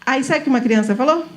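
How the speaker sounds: ordinary talk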